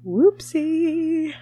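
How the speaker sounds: high-pitched, mock-sheepish admission; playful mock guilt. Playfully owns up to parental failing.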